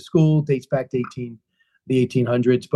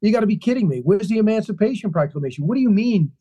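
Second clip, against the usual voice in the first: raised, exasperated complaint; indignant Douglass; 5 semitones higher than usual. Retold or imitated. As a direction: voice Douglass fed up and demanding answers. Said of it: "He said".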